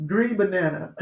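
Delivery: chuckling through the words; mock quote said with a laugh. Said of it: "Then they gonna say".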